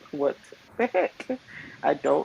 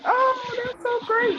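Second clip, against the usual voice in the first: much higher, gushing delight; gushing congratulations. Retold or imitated. Congratulating the young mom, high and gushing. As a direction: squeal it like a thrilled friend. Mock delight at friend's pregnancy.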